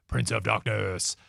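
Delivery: low slow spooky voice; spooky echo. Retold or imitated. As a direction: intone it darkly. Echoing the title in spooky voice.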